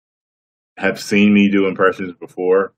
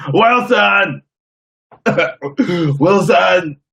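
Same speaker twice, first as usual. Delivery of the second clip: high loud desperate yell of a name; Tom Hanks shout; 12 semitones higher, 5 dB louder, much slower than usual. Retold or imitated. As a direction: cry out across the ocean for a lost friend. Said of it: "Here's my Tom Hanks impression".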